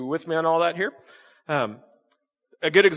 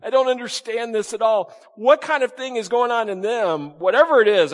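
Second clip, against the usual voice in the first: higher, puzzled disbelief; baffled; 7 semitones higher, 4 dB louder than usual. Pretend. Roman soldier baffled by cheerful Mark. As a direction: sound like you can't figure him out.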